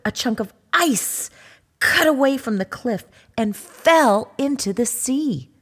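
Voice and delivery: ordinary delivery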